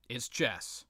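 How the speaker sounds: ordinary delivery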